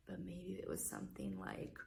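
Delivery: soft unvoiced whisper. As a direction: hush them before the roar. Suspense before dinosaur sound.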